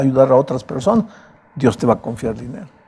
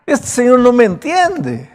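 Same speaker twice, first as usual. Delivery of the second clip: pleading high and loud, voice pressed forward; desperate father pleading; 7 semitones higher, 4 dB louder than usual. Acted. Jairo begs Jesus to hurry, desperate plea. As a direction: beg as a father whose daughter is dying.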